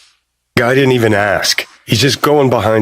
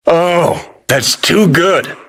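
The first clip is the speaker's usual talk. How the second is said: higher-pitched, drawn-out delight; gleeful amusement; 6 semitones higher, slower than usual. Acted. Relishing the wind-up philosopher in a box, gleeful. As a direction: savor the joke slowly, high and delighted.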